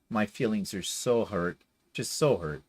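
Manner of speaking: sarcastic, droopy mock sorrow with long so; a lightly hammed-up hurt feelings. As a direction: pretend to be wounded, clearly not. Sarcasm after laughing.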